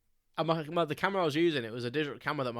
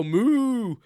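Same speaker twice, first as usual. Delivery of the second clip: loud, high mock cow moo; playful cow impression; silly moo; 9 semitones higher, 10 dB louder than usual. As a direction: bellow a goofy moo at the sheep. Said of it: "And I go".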